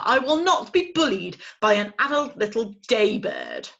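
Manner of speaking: loud, stern owl scolding; cross father owl. Acted. Father owl scolding his owlet. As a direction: lay down the law.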